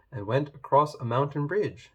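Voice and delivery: ordinary delivery, nothing marked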